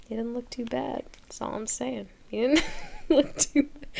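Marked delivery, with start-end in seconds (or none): 2.3-3.9 giggling. Real, not acted. Giggling about young Biden.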